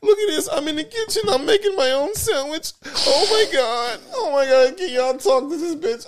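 high, loud whiny fake sobbing; hammed-up tearful whine; mock crying man. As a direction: blubber like a man who had to make his own sandwich. Mocking a crying man on video.

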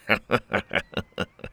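quiet staccato laugh; chuckle. A narrator's reading. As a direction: chuckle smugly to yourself. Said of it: "Then he chuckled".

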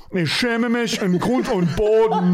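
high-pitched comic German confession; mock-ashamed German. Someone who soiled their pants confessing, high mock-ashamed German. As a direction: wail the German line in mock shame. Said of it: "you might say".

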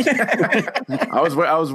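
high, slow words through real laughter; laughing. Real, not acted. Podcaster cracking up at co-host's moon joke, laughing. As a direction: laugh helplessly while starting to speak.

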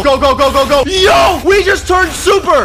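very high, loud excitement; frenzied shouting. Real, not acted. Gamer finishing off the boss, frenzied shouting. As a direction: scream it building to a triumphant peak.

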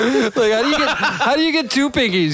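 high falsetto with a laugh. Shown as a demonstration. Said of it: "take a falsetto second".

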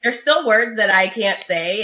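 ordinary talk, nothing marked

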